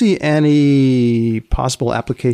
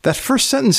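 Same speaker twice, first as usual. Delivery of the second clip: normal talking voice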